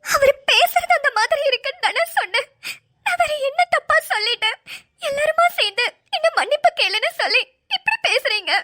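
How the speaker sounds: high, whiny fake sobbing complaint; fake crying. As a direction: turn on crocodile tears to win sympathy. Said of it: "எப்படி எல்லாம் நடிக்கிறாங்க".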